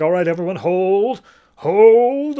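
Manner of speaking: building to a loud high shout of hold; commanding shout. Retold or imitated. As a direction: rein in the troops, rising to a bellow. Shepard commands troops before battle.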